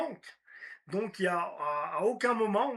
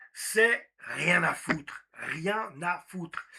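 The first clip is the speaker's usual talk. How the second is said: very slow, weighted delivery; slow punchline delivery; slow emphatic; 3 semitones lower, much slower than usual. Shown as a demonstration. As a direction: lean on each word slowly. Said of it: "le secret dans tout ça".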